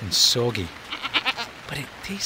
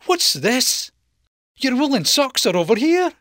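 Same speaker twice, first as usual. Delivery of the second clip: high wife voice; 11 semitones higher, 3 dB louder than usual. Acted. Wife finding the socks.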